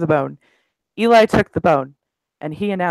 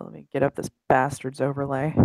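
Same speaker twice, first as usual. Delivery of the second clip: ordinary talk, nothing marked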